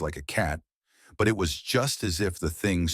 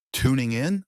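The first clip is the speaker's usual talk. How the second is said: higher, louder questioning echo; puzzled echo; 6 semitones higher, 4 dB louder than usual. Retold or imitated. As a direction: repeat it back as a puzzled question. Puzzled echo of her phrase.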